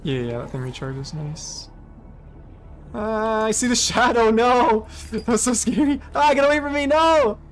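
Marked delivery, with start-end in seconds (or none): 2.9-7.4 high, loud scared cry; startled fright. Real, not acted. Gamer spooked by a shadow underwater. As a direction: shout at the looming shadow.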